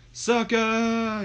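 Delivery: very high, drawn-out catchphrase cry; wrestling catchphrase. Acted. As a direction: hold the word long on one high note. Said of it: "Shane and Book say it together".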